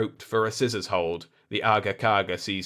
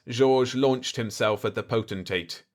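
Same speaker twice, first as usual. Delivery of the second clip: ordinary delivery, nothing marked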